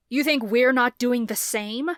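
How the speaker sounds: loud angry snarl. A narrator's reading. Father snarling back at his son, loud and angry. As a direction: bite the question back at him. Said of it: "his dad snarled".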